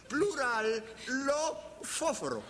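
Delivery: ordinary talk, nothing marked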